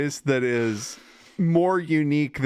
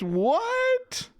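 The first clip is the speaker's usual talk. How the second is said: surprised. Real, not acted. Surprised at hostages question.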